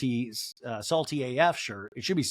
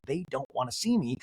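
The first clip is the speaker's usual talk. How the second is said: ordinary talk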